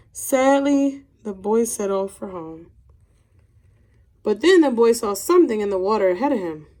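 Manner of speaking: put-on unhurried children's story narration with gentle emphasis; reading to children. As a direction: read it like a picture book to kids, letting the pauses land. Teacher reads picture book aloud.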